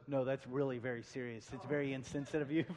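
low, hushed mock disapproval; disapproving room. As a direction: imitate a room turning serious and scolding softly. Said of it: "the whole room goes".

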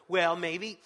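mock needling told-you-so; nagging parent. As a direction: pick at them with a loud, fast nag. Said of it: "come alongside and go".

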